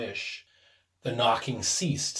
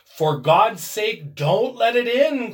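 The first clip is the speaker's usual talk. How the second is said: loud, slow-starting, rising plea of terror; trembling cry; 7 semitones higher, 8 dB louder, slower than usual. A narrator's reading. Old man begging his wife to keep the door shut, terrified. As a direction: beg in dread, climbing in pitch. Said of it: "cried the old man, trembling".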